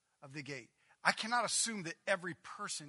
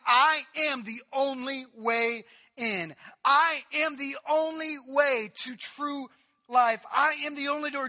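loud, high, slow declaration; full-force preaching; 10 semitones higher, 16 dB louder, slower than usual. Acted. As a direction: declare each I am slowly and loudly. Preaching Jesus's claim.

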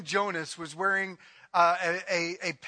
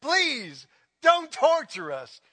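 pleading cry; 6 semitones higher, 6 dB louder, slower than usual. Acted. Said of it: "crying out to Jesus".

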